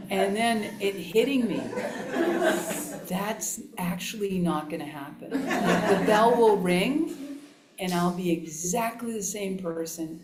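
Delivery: laughing through the retold insight. Real, not acted. Teacher retelling a retreat realization, laughing. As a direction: tell it while you laugh.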